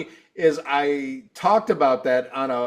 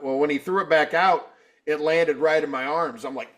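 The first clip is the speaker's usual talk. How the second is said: ordinary talk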